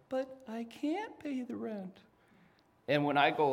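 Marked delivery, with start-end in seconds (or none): 0.1-2.0 high, helpless pleading voice; damsel in distress. Shown as a demonstration. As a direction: wail it like a helpless heroine. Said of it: "you have to say".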